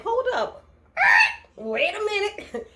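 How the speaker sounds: high, slow "Wait a minute"; commanding stop. Retold or imitated. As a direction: stop him in his tracks. Hannah stopping the cop.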